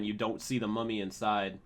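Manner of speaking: normal talking voice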